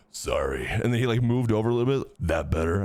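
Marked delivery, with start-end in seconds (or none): 0.1-0.7 deep apology. Retold or imitated. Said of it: "And he was like". 2.2-2.8 deep-voiced question. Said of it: "Why are you saying it like that?".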